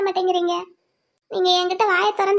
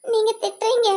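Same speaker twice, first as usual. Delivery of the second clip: tearful; 2 semitones higher than usual. Acted. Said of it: "இப்ப எதுக்கு அழுறீங்க".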